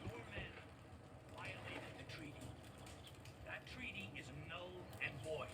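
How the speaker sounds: muffled voice in the distance; as if heard through a wall; heard from afar. Acted. Colonel addressing the chief, heard faintly from a distance.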